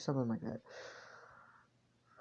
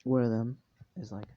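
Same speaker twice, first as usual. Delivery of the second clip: plain reading voice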